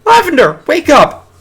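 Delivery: high, loud shout; shouting to wake. Acted. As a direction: yell across the dorm. Schoolgirl shouting to roommate.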